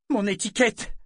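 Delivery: high, loud desperate cry; distraught. A narrator's reading. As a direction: cry out in panic. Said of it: "fit Althea éperdue". (cri éperdu)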